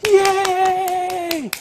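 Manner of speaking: very high, long squealed cheer; loud, sky-high whoop; cheering parent. Acted. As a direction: imitate a thrilled parent's drawn-out yay. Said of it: "what did you do? You was like".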